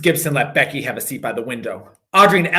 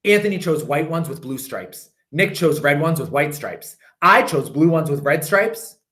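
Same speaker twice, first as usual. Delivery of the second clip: plain reading voice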